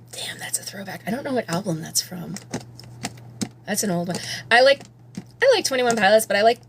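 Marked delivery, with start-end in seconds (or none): 0.1-2.4 soft unvoiced whisper to herself; whispered aside. Real, not acted. Talking to herself about an old song, whispering. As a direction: breathe it out as a private aside.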